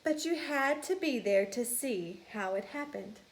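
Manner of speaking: ordinary delivery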